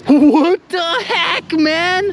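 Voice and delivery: high, slow, stretched-out exclamation; amazed disbelief. Real, not acted. Finder tallying anchor money for viewers, amazed. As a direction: marvel at it in a drawn-out high voice.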